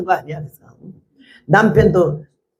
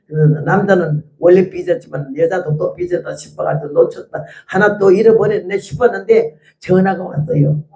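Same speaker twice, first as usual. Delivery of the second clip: ordinary talk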